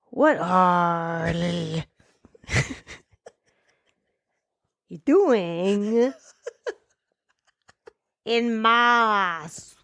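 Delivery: drawn-out, halting Scottish attempt; Scottish accent. Shown as a demonstration. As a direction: try a Scottish Shrek, stretching each word. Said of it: "where he does a Scottish accent".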